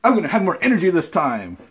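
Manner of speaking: loud, high and upbeat; energetic. Pretend. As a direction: pump up the energy, almost shouting. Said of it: "Look at me, I have energy".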